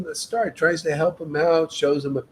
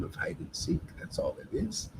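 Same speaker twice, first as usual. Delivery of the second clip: whisper; 12 semitones lower, 14 dB quieter than usual. Real, not acted. Confiding aside in sermon.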